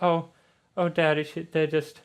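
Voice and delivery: lightly voiced upset little girl; upset Charlotte. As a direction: stammer it out to Daddy. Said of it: "he sees Charlotte crying".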